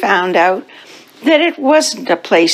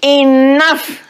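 higher, drawn-out call to order; firm teacher command; 7 semitones higher than usual. Acted. Teacher ending the cats' chase, mildly commanding. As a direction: put a mild stop to the commotion.

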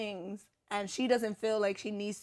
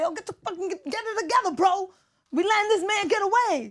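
much higher, louder yell at a bumbling partner; frustrated yelling at partner; 11 semitones higher, 6 dB louder than usual. Pretend. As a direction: chew out your useless partner mid-chase. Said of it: "I'm telling him".

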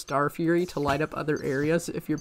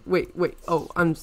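normal talking voice

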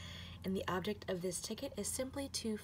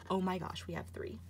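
ordinary delivery

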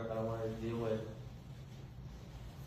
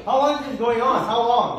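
loud, high, hammering question; angry demand; 8 semitones higher, 14 dB louder than usual. Acted. Demanding of his girlfriend how long, shouting. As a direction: yell it, demanding the truth.

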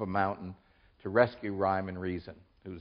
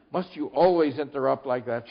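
higher, louder mild rebuke; lightly acted irritation; irritable; 4 semitones higher, 6 dB louder than usual. A narrator's reading. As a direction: scold with a touch of impatience. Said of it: "said Tock irritably".

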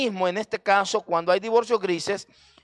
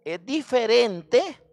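diferente stretched out slowly with a rising end; slow emphatic stress; 3 semitones higher, much slower than usual. Acted. Preacher slowing down on diferente so the church feels the contrast. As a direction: lean on diferente, slowing each syllable.

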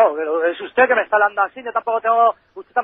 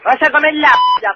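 higher, louder furious yelling; furious shout; 5 semitones higher, 6 dB louder than usual. Real, not acted. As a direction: explode at the caller. Furious insults on the phone.

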